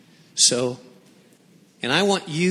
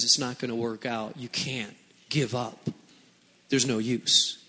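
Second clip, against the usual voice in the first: much softer and lower, hopeless; discouraging inner voice; 8 semitones lower, 7 dB quieter than usual. Acted. Pastor voicing the inner voice of despair, low and soft. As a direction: murmur it like creeping doubt.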